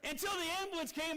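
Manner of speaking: ordinary talk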